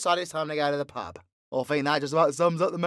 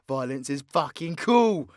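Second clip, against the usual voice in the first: ordinary talk, nothing marked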